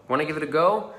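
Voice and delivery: ordinary talk, nothing marked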